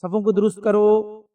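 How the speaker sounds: صفوں کو درست کرو said slow and drawn-out like a mosque call; imam's announcement. Retold or imitated. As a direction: stretch out صفوں کو درست کرو as an imam calls the rows. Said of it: "اعلان کرتے".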